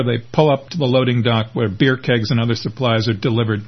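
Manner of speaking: ordinary delivery, nothing marked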